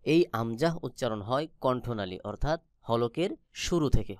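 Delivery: ordinary talk, nothing marked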